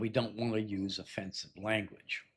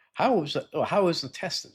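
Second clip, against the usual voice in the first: questioning friend; 8 semitones higher, 5 dB louder than usual. Acted. Acted dialogue question.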